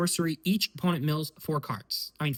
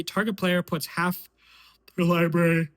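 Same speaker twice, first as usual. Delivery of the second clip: yawning; 4 semitones higher, 4 dB louder than usual. Real, not acted. Host reading card text to viewers, yawning. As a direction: keep reading as a yawn takes over. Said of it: "I'm sorry that I yawned".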